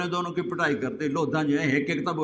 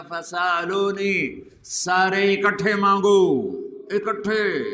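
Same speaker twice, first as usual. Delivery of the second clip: raised, drawn-out proclamation; resounding summons; 4 semitones higher, 4 dB louder, slower than usual. Acted. Preacher voicing God's call to all mankind, ringing summons. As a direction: call out to a vast crowd, stretching the words.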